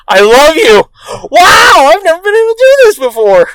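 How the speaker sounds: high, loud gush of wonder; mock amazed gushing. Husband thanks wife for his discovery, mock amazed. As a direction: overdo the awestruck thanks.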